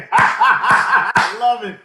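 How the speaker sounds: high, drawn-out words through laughter; laughing. Real, not acted. Guest delighted by the host's joke, laughing. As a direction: crack up while saying it.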